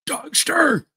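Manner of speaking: very high, loud cartoon-kid call; Dennis the Menace. Podcast host mimics his friend for a listener's comment, squeaky kid voice. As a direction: squawk it out like a pesky little boy. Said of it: "his best Dennis the Menace voice".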